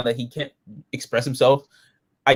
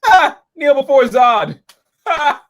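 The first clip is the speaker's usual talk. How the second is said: high, loud, drawn-out villain proclamation; loud, over-the-top villain parody; mock Zod; 10 semitones higher, 5 dB louder, slower than usual. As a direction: ham it up as a slow, high tyrant. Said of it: "I'm like".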